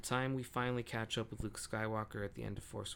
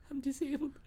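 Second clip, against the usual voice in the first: panicked squeak; 4 dB louder than usual. Retold or imitated. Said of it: "He's like".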